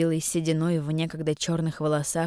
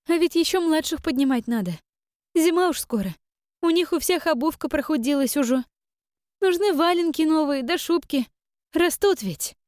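too much caffeine; 10 semitones higher, 3 dB louder than usual. A narrator's reading. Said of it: "тараторила Таша".

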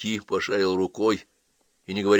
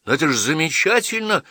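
high, louder enthusiastic exclamation; exclaiming; 8 semitones higher, 5 dB louder than usual. Acted. Boy praises feeding the hungry, exclaiming. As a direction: exclaim with naive delight. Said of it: "воскликнул Джон".

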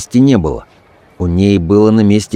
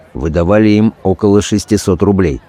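usual speaking voice

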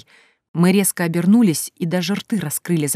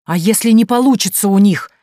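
loud, raised-pitch challenge; barked question; 4 semitones higher, 8 dB louder than usual. A narrator's reading. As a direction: challenge her loudly and impatiently. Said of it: "рявкнул Бажен".

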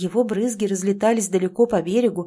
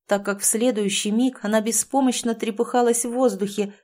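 plain reading voice

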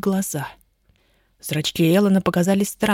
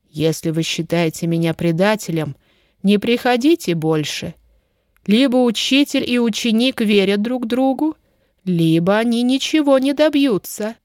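plain reading voice

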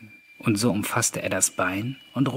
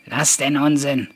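louder, higher impatient call; 4 semitones higher, 7 dB louder than usual. A narrator's reading. Ghost to the reluctant father, calling out. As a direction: call it out to cut off the excuse. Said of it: "rief der Geist".

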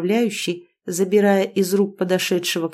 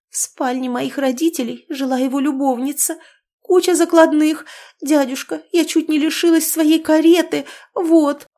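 tearful complaint; 8 semitones higher, 4 dB louder than usual. A narrator's reading. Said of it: "стыдливо прошептала".

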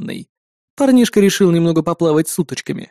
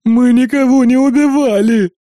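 whining; 6 semitones higher, 4 dB louder than usual. A narrator's reading. Twin being tied with cable, whining. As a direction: whine your innocence at the captors. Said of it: "ныл тот, что в одеялке".

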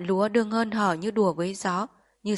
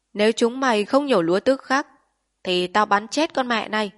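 menacing threat; 3 semitones higher, 6 dB louder than usual. Acted. Japanese officer threatening villagers with gun drawn, loud menace. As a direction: bark the ultimatum at the villagers. Gun at old woman's head.

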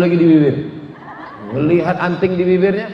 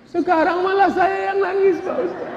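whiny husband; 13 semitones higher than usual. Acted. Husband complaining he now cries.

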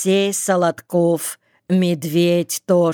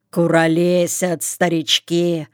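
plain reading voice

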